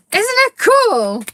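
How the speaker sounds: high, slow, thrilled admiration; excited. Real, not acted. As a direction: marvel at it out loud.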